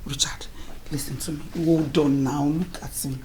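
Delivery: ordinary delivery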